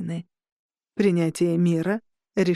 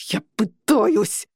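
loud, slow frustrated growl; 4 dB louder, much slower than usual. Acted. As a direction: bark back under strain. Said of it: "прорычал Джордж". (громкий медленный рык)

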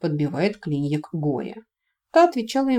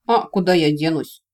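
plain reading voice

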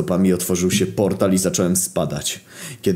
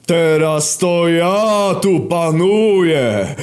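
bellowing villain monologue; very slow, high, drawn-out megalomaniac roar; Warol Kojtyła; 12 semitones higher, much slower than usual. Acted. Villain's roaring monologue as hell's ruler.

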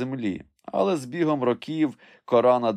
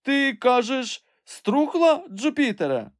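high, slow, anxious question; trembling voice; 4 dB louder, slower than usual. A narrator's reading. Master learns the branch is rotten, trembling call. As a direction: ask in dismay, voice pitched high. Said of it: "тремтячим голосом промовив Легран".

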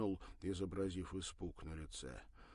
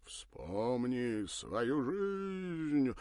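very slow, doleful chant; mournful theatrical drone; 5 semitones higher, 5 dB louder, much slower than usual. Acted. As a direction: drone it like a stage hypnotist. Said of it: "затянул заунывно-театральным голосом".

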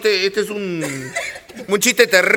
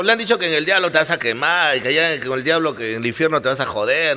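lightly voiced devil; 7 semitones lower, 3 dB quieter, faster than usual. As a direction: lower devil voice dropping. Devil continues. (diablo con voz más grave)

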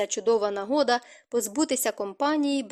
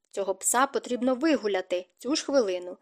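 ordinary delivery, nothing marked

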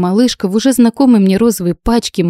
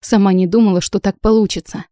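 usual speaking voice

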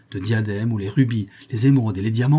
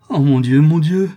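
loud, higher cry to heaven; overwhelmed cry; 5 semitones higher, 9 dB louder than usual. A narrator's reading. Countess falling to her knees, overwhelmed cry. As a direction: cry out, voice swelling and rising. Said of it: "s'écria Sarah en tombant à genoux".

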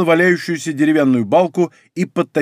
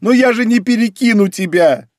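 high, raised cry of desperation; frantic high-pitched call; desperate shouting; 6 semitones higher, 3 dB louder than usual. A narrator's reading. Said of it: "кричала она".

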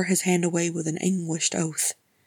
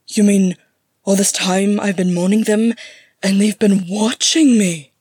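loud, incredulous outburst; astonished outrage; 3 semitones higher, 10 dB louder than usual. Acted. Gimli hears his mourned kin watched him, astonished.

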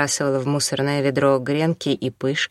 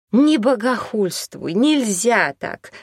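higher, slow, outraged cry; 6 semitones higher, slower than usual. Acted. Наталья rebuking Елена for blasphemy, a slow outraged cry. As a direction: shout it out in pious outrage, slowly. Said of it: "закричала Наталья Владимировна".

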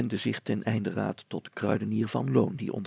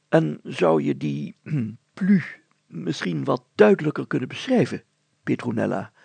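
a puzzled small-town mayor choosing words; mayor asking carefully, searching for words; hesitant mayor; 3 semitones higher, 6 dB louder than usual. Acted. Mayor questions Petronella.

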